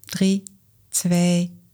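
calm guided exercise voice; walk them through it gently; guided breathing. Acted. Leads the exercise together.

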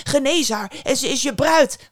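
high, loud, urgent begging; pleading outcry. A narrator's reading. King begs the boy to heal his daughter, loud pleading. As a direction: cry out a desperate bargain. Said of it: "riep hij uit".

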